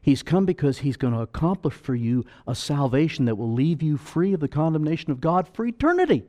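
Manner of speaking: ordinary talk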